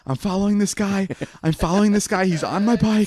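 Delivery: high, mock-frantic phone plea; breathless panic. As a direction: imitate a winded kid calling police. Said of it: "I'm just really out of breath".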